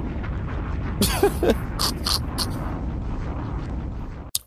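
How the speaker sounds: long high breathless laugh; long wheezing laugh. Real, not acted. Comedian wheezing at his own joke, long high laughter.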